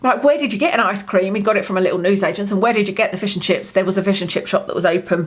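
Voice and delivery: usual speaking voice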